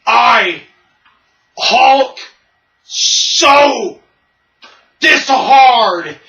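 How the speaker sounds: halting monster shout, one word at a time; Hulk. Retold or imitated. Hulk at the podium struggling to speak, loud and halting. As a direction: bellow it in broken, separate words. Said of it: "looking like the Hulk, and he's like".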